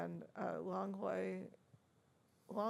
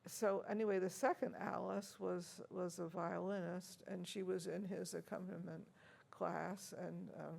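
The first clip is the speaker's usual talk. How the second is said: ordinary talk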